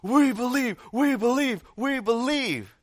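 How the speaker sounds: high, loud repeated chant; imitating a crowd's insistent roar. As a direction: shout like a fervent crowd. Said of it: "with even greater volume".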